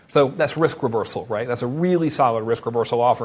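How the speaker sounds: ordinary delivery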